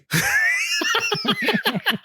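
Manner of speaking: high, shrieking burst of laughter. Real, not acted. Joke about dancing with Diane Wiest.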